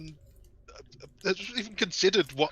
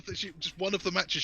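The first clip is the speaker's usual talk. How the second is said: ordinary talk, nothing marked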